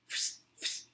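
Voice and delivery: unvoiced fs hiss; soft hissing fs sound; fluid spray noise. Shown as a demonstration. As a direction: hiss like fluid spurting from a nozzle. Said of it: "like the noise it makes".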